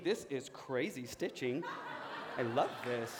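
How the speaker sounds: low, slow mock absorption in a trifle; feigned fascination. Staff avoiding an awkward argument by studying their clothes, feigned fascination. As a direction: imitate someone pretending to be engrossed, low and soft.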